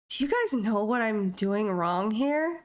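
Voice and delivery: ordinary talk, nothing marked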